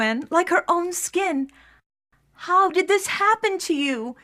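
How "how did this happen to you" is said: pitched up in a half-acted cry of dismay; lightly voiced, upset sister calling out; distressed cry. A narrator's reading. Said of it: "she cried".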